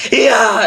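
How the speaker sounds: a sudden very high loud yell; imitated high yell. Copying the band singer's high yell. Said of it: "he can do yells where it's like".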